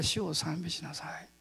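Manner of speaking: soft, hushed inner voice. Retold or imitated. The Spirit's other voice answering him, soft and hushed. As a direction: voice it gently, like a quiet voice within. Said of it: "もう一つの声が".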